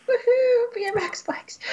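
high cheer dropping to a whisper; mock cheer. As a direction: cheer then breathe it out. Mock cheer about BMX.

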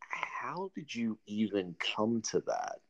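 slow, breathless whisper of disbelief. Retold or imitated. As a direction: whisper it slowly, baffled. Retold reaction to friend's idea.

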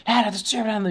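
high-pitched mock scolding; imitating a scolding mom. Recalling his friend's mom scolding, high mock scold. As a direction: mimic the mother snapping at her son. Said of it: "la vez que nos asustó que te regañó".

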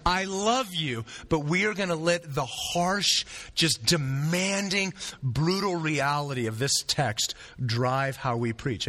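ordinary delivery

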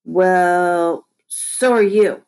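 stall, then blurt out a comeback; sputtered comeback. A narrator's reading. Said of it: "I sputtered".

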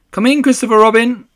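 calling out. Acted. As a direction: call toward the door. Pooh calling out in the dark.

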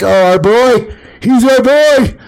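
very high, loud gushing parody; mock doting parents. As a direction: coo loudly over a precious son.

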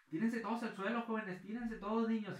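teacher yelling at the class to drop to the floor; teacher ordering the kids down. Retold or imitated. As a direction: get the kids under the desks now. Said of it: "la maestra de inglés".

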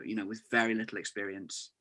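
ordinary talk

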